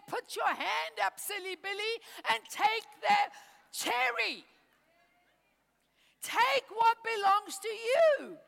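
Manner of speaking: lightly voiced God telling a silly child to take it; God scolding gently. God's voice in sermon.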